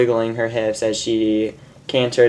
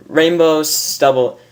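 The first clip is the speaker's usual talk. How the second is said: usual speaking voice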